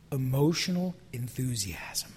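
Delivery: hushed whisper. Real, not acted. Preacher warns his congregation, whispered. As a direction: breathe the words out. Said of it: "repeated phrase".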